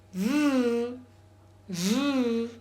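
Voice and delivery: V as a long voiced buzz, twice; isolated consonant sound. Shown as a demonstration. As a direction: buzz out V alone, then repeat v. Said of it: "I am going to pronounce".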